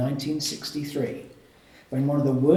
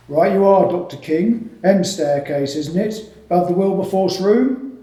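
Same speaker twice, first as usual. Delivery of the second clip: hearty porter; 4 semitones higher, 8 dB louder than usual. A narrator's reading. Porter voiced in reading.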